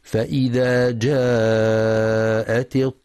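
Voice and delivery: slow, measured tajweed chant; Quran recitation. Acted. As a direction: recite it slowly and melodically.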